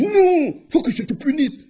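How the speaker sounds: high-pitched protesting hyena; high refusal. Acted. Hyena refuses the hare's food, high protest.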